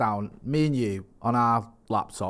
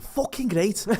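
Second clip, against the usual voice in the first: gleeful high Scouse outburst; imitation of a delighted Scouser; Scouse accent; 11 semitones higher, slower than usual. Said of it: "that's me doing a Scouse accent".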